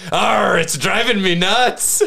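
pirate voice. Retold or imitated. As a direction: deliver the pirate punchline slowly. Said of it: "what the pirate says".

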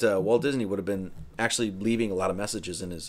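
ordinary delivery

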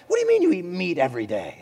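a hint of a bewildered monarch; old Persian king. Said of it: "like, an old Persian king showed up".

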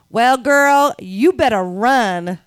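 drawn-out sassy American woman. Retold or imitated. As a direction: stretch it out like a sassy American airline worker. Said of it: "you did the voice".

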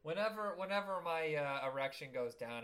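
ordinary delivery

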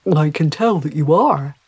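polite fish addressing a lady; courteous talking trout. Acted. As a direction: speak up as a well-mannered trout. Talking trout replies to Mary.

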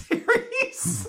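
laughing through the words; amused, words breaking into laughs; real laughter. Real, not acted. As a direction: crack up while finishing the line. Laughing at forgotten ghost premise.

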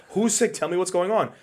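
high, imitated panicked questioning; retold freak-out. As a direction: mimic her alarm, pitch way up. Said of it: "started freaking the fuck out".

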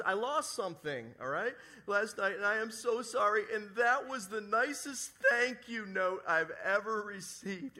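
lightly voiced weepy neighbor; imitating her choked-up thanks; tearful apology.

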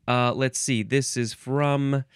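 normal talking voice

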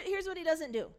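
ordinary talk, nothing marked

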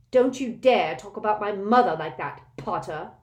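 loud angry snarl at Potter. A narrator's reading. Malfoy defending his mother to Harry, loud angry snarl. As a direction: spit the warning out. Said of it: "Malfoy snarled".

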